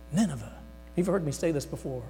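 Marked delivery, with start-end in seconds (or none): 0.1-0.5 dumbfounded gasp. Retold or imitated. Jonah dumbfounded by God's order.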